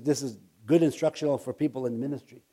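ordinary talk, nothing marked

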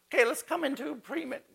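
high-pitched mock piety; imitated eager believer. Someone offering to agree in prayer, high imitated eagerness. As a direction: lightly mimic a keen prayer partner.

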